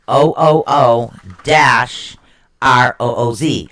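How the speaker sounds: "O O O dash R O O Z" said slowly, letter by letter; spelled-out pronunciation. Shown as a demonstration. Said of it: "it's pronounced".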